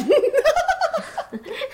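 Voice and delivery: high, scornful burst of laughter; mocking laugh. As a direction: laugh him off with contempt. Mocking the absent landlord.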